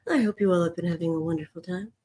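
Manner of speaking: smooth, fast public radio host delivery; NPR voice. Acted. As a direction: read it like a calm radio host. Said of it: "I'm using an N P R voice".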